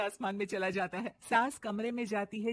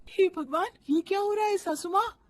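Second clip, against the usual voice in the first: alarmed; 10 semitones higher, 6 dB louder than usual. Acted. Bride alarmed.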